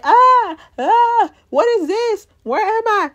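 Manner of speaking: high, loud panicked cries; imitating a man waking up trapped and terrified; freaking out. Brick waking up stuck under the house, panicking high and loud. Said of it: "he's freaking out".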